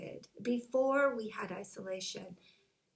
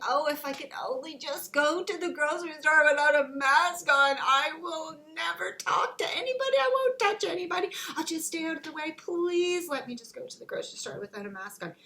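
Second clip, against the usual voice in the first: high, louder, mock-begging voice; pleading bargainer; 7 semitones higher, 7 dB louder than usual. Isolated person bargaining to shop without a mask, pleading. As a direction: beg like a child for one small favour. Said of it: "just saying".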